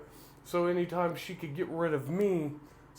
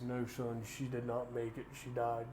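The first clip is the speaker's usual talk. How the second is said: softly breaking sad news; 3 semitones lower, 6 dB quieter than usual. Retold or imitated. Father breaks news of death.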